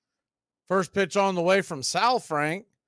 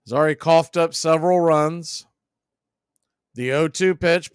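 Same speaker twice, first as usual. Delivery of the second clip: normal talking voice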